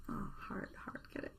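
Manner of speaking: very soft, almost whispered joke; hushed aside. Real, not acted. Teacher slips viewers a pun on heart, hushed aside. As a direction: drop to a near whisper for the pun.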